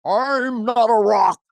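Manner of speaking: slow, high, drawn-out voice of a rock waking up; rock come to life. Pretend. Man voicing a rock that wakes up as Nixon, slow and high. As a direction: stretch it out high and slow like a newborn creature.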